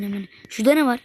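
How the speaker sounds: ordinary talk, nothing marked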